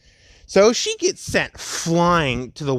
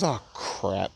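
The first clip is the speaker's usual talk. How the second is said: dismayed mutter; 6 semitones lower, 8 dB quieter than usual. Acted. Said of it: "And he goes".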